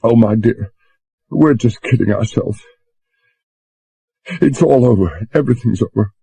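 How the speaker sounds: a touch of sobbing despair; between sobs. A narrator's reading. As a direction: Susan giving up hope, mildly tearful. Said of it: "said between sobs".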